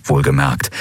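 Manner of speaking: plain reading voice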